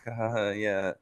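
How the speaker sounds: soft mock laugh; Stephanie. Retelling Stephanie laughing along beside Undertaker, imitated laughing. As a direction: fake a quiet little polite laugh. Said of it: "laughing, like".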